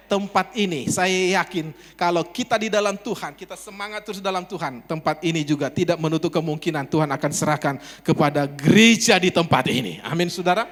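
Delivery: loud, full-force sermon delivery; rally the congregation, projecting hard; fervent, booming declaration of faith; rousing preaching. Real, not acted.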